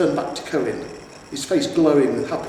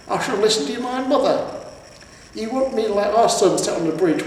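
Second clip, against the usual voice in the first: high, shrill-raised berating; nagging troll wife; 7 semitones higher, 4 dB louder than usual. A narrator's reading. Troll wife berating her husband, high and cross. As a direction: tear into the husband.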